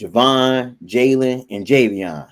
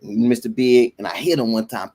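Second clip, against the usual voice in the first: ordinary delivery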